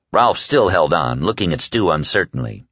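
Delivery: ordinary delivery, nothing marked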